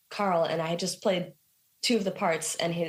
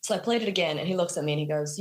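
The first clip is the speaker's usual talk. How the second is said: ordinary talk, nothing marked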